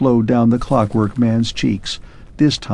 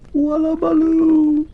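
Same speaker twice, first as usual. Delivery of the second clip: much higher, slow, far-off echoing call; distant echo. Acted. Vanished clockwork man's last echo to Arthur, distant high call. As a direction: sound from far away. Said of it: "echoed through the months".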